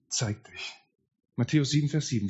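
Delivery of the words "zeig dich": softly breathed prayer; whispered plea. Retold or imitated. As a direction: barely voice the plea. Prayer pleading to Jesus. (geflüsterte, leise Bitte an Jesus)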